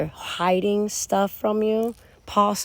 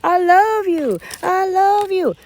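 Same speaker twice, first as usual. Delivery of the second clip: much higher, louder gushing mock; mock King of Cups; 9 semitones higher, 3 dB louder than usual. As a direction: ham up the fake healed lover. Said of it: "Now he's like".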